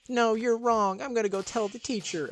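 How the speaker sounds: put-on high whiny girl voice; high girl threat. Girl threatens to report him to the teacher, high and whiny. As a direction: threaten to tell, in a squeaky voice.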